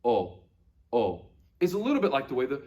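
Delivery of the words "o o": two steady round O sounds; pure Latin O. Shown as a demonstration. Teacher repeating the Latin letter for pupils, pure O. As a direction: say "O" twice, no glide. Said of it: "your mouth doesn't move".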